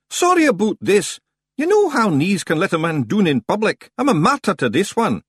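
high, loud Scots excuse; apologetic Scots stranger. Acted. Stranger apologizing for his knee, high loud Scots.

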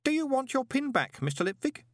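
very high, eager question; helpful boy. A narrator's reading. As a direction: offer it as an innocent lad.

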